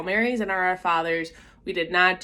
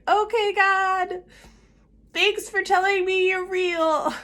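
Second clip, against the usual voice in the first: high, drawn-out mock address; awkward address to God; 12 semitones higher than usual. Teen awkwardly ends her first talk with God, high-pitched. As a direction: call up to God in a high, stiff voice.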